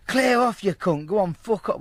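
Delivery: high, loud imitated send-off; mock angry shout. Geezer to caller banging on door, mock angry shout. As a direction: shout them away from the door, acting it. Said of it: "Well, not like that".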